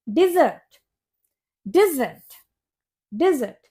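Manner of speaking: डिजर्ट as "di-ZURT", repeated with pauses; dessert pronunciation. Shown as a demonstration. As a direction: model डिजर्ट slowly for learners, short "di" first. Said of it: "लेकिन डिजर्ट की spelling होती है". (डिजर्ट, छोटा "डि" और फिर "ज़र्ट")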